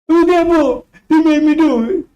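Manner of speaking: mock the coward shifting blame; mock whining excuse. Mocking Aaron blaming the people.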